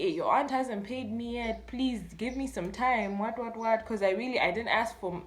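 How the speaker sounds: ordinary talk, nothing marked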